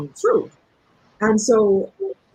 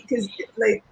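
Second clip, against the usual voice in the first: ordinary talk, nothing marked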